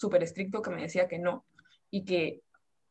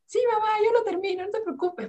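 compliant daughter; 12 semitones higher than usual. Retold or imitated. Teen answering her strict mother.

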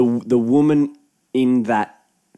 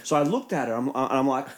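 ordinary talk, nothing marked

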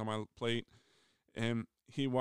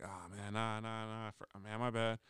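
sheepish mumble; 3 semitones lower, 6 dB quieter than usual. Retold or imitated.

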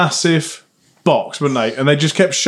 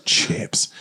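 reverent whisper; 8 dB quieter than usual. Acted. Reverent food praise.

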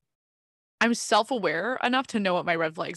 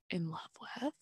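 voiceless sheepish whisper; embarrassed whisper; 13 dB quieter than usual. Real, not acted. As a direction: drop to a shy whisper. Embarrassed admission of crush.

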